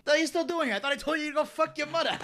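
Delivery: high wiseguy impression, breaking into laughter; Joe Pesci. Storyteller plays Pesci mouthing off to cop, high mock indignation. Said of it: "you got his, uh, impression down".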